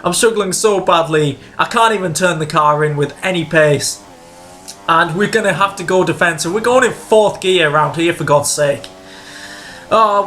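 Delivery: usual speaking voice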